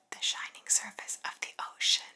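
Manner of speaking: ordinary delivery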